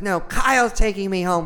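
put-on high, loud, slow drunken yell; drunk girl yelling. Drunk girl yelling at the big guy. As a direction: yell it like a sloppy drunk woman. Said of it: "she starts yelling at him".

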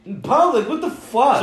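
loud, high incredulous outburst; real shock. Real, not acted. Friend hears an embarrassing confession in a game, loudly shocked. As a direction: blurt it out, appalled, pitch leaping up.

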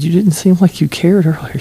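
high, mock-scolding tease; playful mock reproach; teasing. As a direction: needle her playfully. Teasing the neighbour woman.

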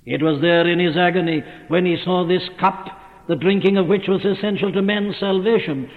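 ordinary talk, nothing marked

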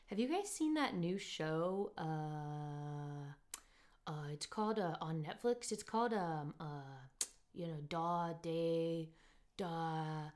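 slow, halting imitation of a dull drunk man; monotone drunk guy. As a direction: drag it out, lost for the word. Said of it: "He was very monotone, very awkward, very long sentences".

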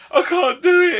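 high, loud wailing "I can't do it"; retold breakdown. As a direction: whine it like a broken new dad.

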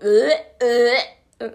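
loud, grossed-out "uh" noises; disgusted retching noise. Retold or imitated. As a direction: blurt out two gagging sounds. Mimes the tongue kiss in disgust.

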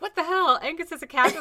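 indignant. Retold or imitated. Retold indignant quote.

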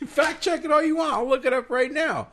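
high laughing voice. Real, not acted. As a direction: talk through laughter. Laughing while replying.

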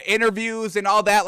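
ordinary talk

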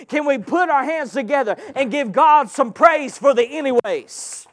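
loud, driving preacher's cry to the congregation; full-force preaching. Acted.

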